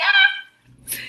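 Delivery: high loud frustrated cry; exasperated yell. Acted. Exasperated outburst.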